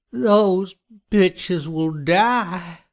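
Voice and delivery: high, very slow vengeful groan; groaning killer. A narrator's reading. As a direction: drag the threat out in a groan. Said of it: "Johnny groaned".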